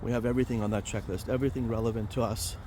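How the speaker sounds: ordinary talk, nothing marked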